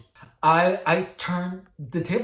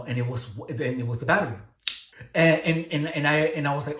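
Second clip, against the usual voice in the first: ordinary talk, nothing marked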